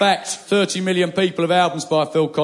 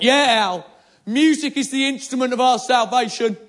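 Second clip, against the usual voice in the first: grand proclamation; 10 semitones higher than usual. Retold or imitated. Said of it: "And I went".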